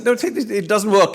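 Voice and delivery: high, insistent retold protest; lively mock exasperation; retold objection. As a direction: argue it again, pitched well up. Retells argument over the rule.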